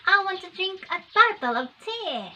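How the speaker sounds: high-pitched mock British accent; imitated British accent. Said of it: "the child says".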